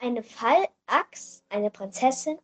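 plain reading voice

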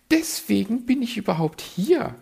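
high, preening bird voice; a vain magpie fishing for compliments. Acted. Magpie answers.

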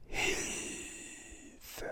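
faint breathy call for help from afar; a distant, barely voiced plea; ghostly whisper. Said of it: "ein weiteres Flüstern hörte".

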